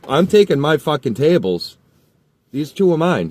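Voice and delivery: loud, high insisting shout; angry shout. Retold or imitated. To the kid blocking him, loud insistent shout. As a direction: claim the tables at full voice. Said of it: "I'm like".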